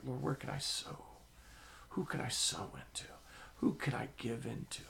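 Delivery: quiet, half-whispered asking; soft prayer. Retold or imitated. Preacher quietly asking the Lord where to sow. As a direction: ask the Lord softly.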